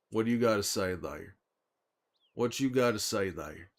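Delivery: ordinary delivery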